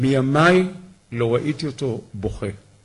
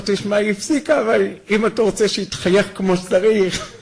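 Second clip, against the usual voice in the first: laughing while quoting; 7 semitones higher, 3 dB louder than usual. Retold or imitated. Tractor driver's joke, laughing.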